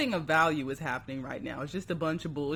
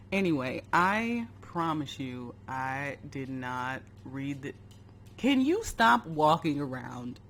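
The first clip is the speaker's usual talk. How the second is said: usual speaking voice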